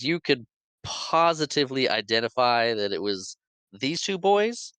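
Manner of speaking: drawn-out list rising to a doubtful question; slow skeptical cross-examination. Retold or imitated. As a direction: spell it out slowly, building to disbelief. Lawyer cross-examining a doubtful witness.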